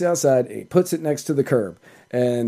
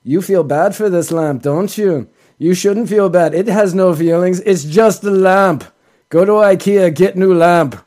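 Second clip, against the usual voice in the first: louder, higher Swedish accent, stoned-friend impression; deadpan Swedish pitchman telling you it's just a lamp; 5 semitones higher, 6 dB louder than usual. Swedish ad man scolding viewers for pitying a lamp, in a loud Swedish accent. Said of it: "in a very Swedish accent".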